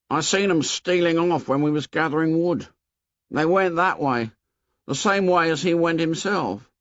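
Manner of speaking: a hint of fat anxious Piggy telling tales; Piggy voiced. A narrator's reading.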